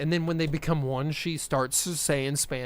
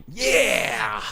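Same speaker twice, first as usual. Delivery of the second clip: cheering; 10 semitones higher, 7 dB louder than usual. Retold or imitated. Mocks the audience cheering.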